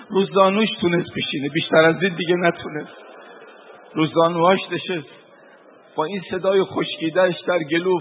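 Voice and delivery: loud, chanted mourning sermon; rising lament. Acted. Preacher recounting the martyrdom to mourners, loud lamenting chant. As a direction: cry the martyr's story out to the mourners, building in force.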